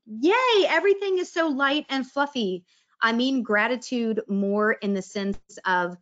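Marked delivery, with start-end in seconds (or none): 0.1-2.6 much higher, sing-song fake delight; mock cheerful. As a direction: parody sugary positivity. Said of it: "I don't mean gratitude as in".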